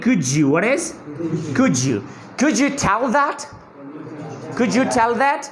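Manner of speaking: "Could you" joined as "cud-ju"; j liaison. Shown as a demonstration. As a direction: blend "could you" with a j sound. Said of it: "तो आप कहेंगे".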